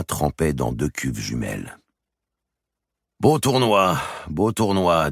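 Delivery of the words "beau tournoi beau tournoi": pleased king. Acted. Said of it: "disait Philippe".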